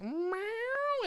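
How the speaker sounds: high drawn-out meow; cat meow. Retold or imitated. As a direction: mew like a cat, high and long. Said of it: "it just looks at me and goes".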